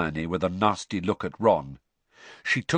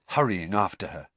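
plain reading voice

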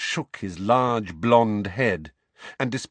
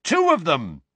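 loud, raised, emphatic addition; heated insistence; 9 semitones higher, 6 dB louder than usual. A narrator's reading. Boy insisting to his disbelieving uncle, heated. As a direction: insist on it hotly.

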